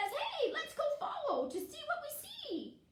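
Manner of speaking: high-pitched hare voice; high storybook animal voice; eager Hare. Acted.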